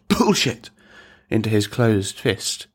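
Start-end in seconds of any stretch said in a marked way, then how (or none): 0.1-0.7 high, sudden cough wrapped round the word. Acted. Class comedian heckling the teacher into his fist, word coughed high. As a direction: disguise the word inside a short cough. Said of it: "who coughed the word".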